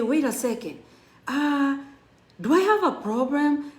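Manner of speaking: retold panic. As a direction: let a little rising panic creep in. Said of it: "And I started panicking".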